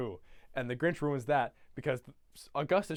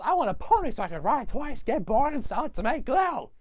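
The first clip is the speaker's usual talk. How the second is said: high, loud bratty whine; mock whining; 9 semitones higher, 6 dB louder than usual. Grinch mocking greedy Whos, high loud whine. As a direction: pitch it up like a demanding brat.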